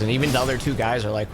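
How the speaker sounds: usual speaking voice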